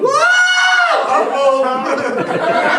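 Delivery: hammed-up high-pitched country yell. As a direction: whoop it out in wild delight. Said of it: "a redneck like me going".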